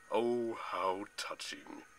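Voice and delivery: slow, drawn-out villain voice; deep villain voice. A narrator's reading. The general interrupts Zoe's tearful reunion, deep-voiced. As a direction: savor it slowly in a deep voice. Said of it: "a deep voice boomed".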